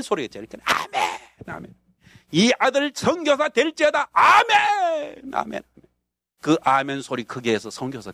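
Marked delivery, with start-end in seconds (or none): 2.3-4.1 prayer blessing. Retold or imitated. Preacher's prayer over him. 4.1-5.6 long, drawn-out shouted amen, pitched high. Retold or imitated. Young believer outshouting the elders with his amen, drawn out. Loud amen in prayer meeting.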